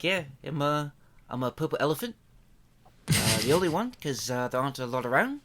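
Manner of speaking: soft, hesitant New Zealand accent; Taika Waititi. Shown as a demonstration. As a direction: voice a toy elephant in a mild New Zealand accent. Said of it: "kinda has a Taika Waititi, like, New Zealand type thing".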